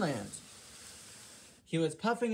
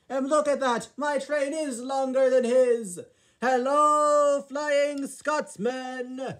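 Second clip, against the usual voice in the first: much higher, louder calling out; loud boastful greeting; 8 semitones higher, 7 dB louder, slower than usual. Acted. Gordon calling to Flying Scotsman while showing off, loud and high. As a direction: shout it across to your brother.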